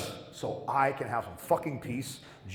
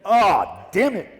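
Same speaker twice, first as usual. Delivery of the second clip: loud, higher "ah, damn it"; exasperated outburst; 8 semitones higher, 11 dB louder, slower than usual. Retold or imitated. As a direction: blurt it out in loud frustration. Forced to hand over socks.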